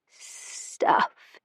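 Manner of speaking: a slow, hissing whisper like a kettle's first steam; effortful whisper. A narrator's reading. Frail grandmother teasing her grandson, whispered. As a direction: breathe the word out in a faint hiss. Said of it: "Her reply took effort, the sound a slow susurration".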